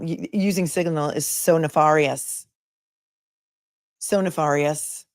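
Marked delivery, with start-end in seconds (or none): 1.3-5.1 NEFARIOUS said slowly with an open AH, nuh-FAR-ee-us; his pronunciation. Retold or imitated. Host mimicking the lawyer's word, slow broad-A "nefarious". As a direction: imitate his drawn-out "nefarious", FAR as in car. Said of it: "That's how he pronounces it".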